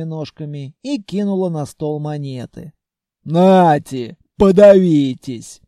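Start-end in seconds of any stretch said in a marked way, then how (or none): 3.2-5.6 loud, drawn-out troll bellow; gruff anger. A narrator's reading. Khalya flings coins to the buyers, loud gruff anger. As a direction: throw it out roughly and rudely.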